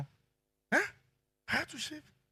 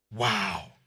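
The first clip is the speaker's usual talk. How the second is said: usual speaking voice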